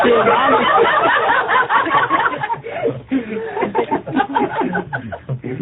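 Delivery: long high laugh. Real, not acted. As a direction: crack up at the punchline. Laughter after joke.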